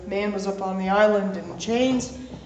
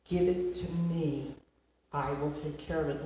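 gentle Lord's voice; 4 semitones lower, 8 dB quieter than usual. Acted. The Lord voiced comforting.

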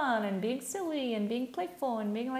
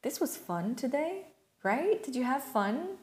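put-on higher, light friendly voice to a little kid; talking to inner child; 5 semitones higher, slower than usual. Shown as a demonstration. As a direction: coax a child gently into chatting. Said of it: "And be like".

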